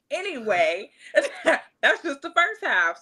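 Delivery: high laugh through the words. Real, not acted. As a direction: laugh while you talk.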